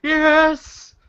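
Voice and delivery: high-pitched triumphant exclaiming; real elated disbelief. Real, not acted. Player surprised by a successful roll, elated. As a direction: shriek it in amazed delight.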